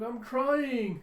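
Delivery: put-on high-pitched child's wail; hammed-up little kid protest; Anya. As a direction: whine it out high, play-acting. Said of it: "you have your Anya be like".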